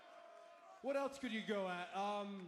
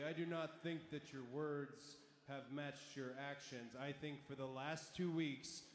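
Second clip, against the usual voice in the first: low and grave; serious low accusation; 6 semitones lower, 4 dB quieter than usual. Acted. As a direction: deliver it gravely, quietly.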